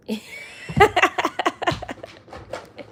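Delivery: high, breathless laugh; amused laugh. Real, not acted. Mother amused her toddler won't say it, high airy laugh. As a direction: laugh in a long, airy run.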